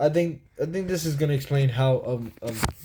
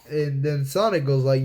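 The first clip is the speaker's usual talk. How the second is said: ordinary delivery, nothing marked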